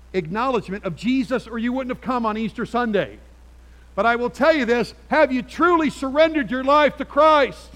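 loud, high preaching push; preaching at full force. Acted. Pastor pressing the congregation, preaching at full force. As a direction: drive it home from the pulpit.

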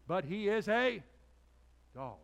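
high, loud build-up to a punchline; exasperated emphasis. Real, not acted. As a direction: belt it out in mock exasperation. Exaggerated complaint about the dog.

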